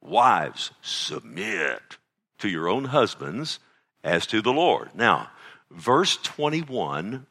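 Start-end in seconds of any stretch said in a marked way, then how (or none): 0.0-1.8 mock husband quoting. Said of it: "the husband loves to start right here".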